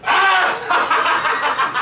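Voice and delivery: loud villain cackle with a shout; evil laugh. Acted. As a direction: throw back your head and cackle. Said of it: "Then do your evil laugh".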